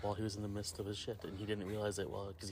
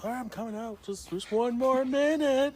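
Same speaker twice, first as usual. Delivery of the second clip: high singsong, swelling to a long loud finish; teasing stalling; 12 semitones higher, 7 dB louder than usual. Retold or imitated. Prankster answers friend banging on door, teasing singsong stalling. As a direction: stretch out the last words to annoy him.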